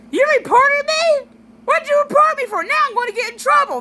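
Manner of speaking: loud, high, whiny mock outrage; over-the-top high-pitched sibling whine; annoyed brother. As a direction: imitate a brother squawking indignantly at his sister. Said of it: "he did it like, um, an, an annoyed brother".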